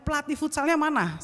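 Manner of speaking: heated question; worked-up demand. Retold or imitated. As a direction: demand to know, re-enacting the panic. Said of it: "bawaannya langsung emosi".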